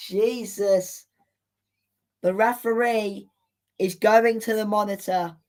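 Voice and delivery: ordinary delivery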